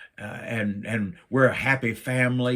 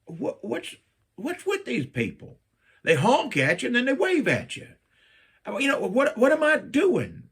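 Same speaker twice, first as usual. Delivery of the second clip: bewildered; 12 semitones higher than usual. Retold or imitated. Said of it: "I'm like".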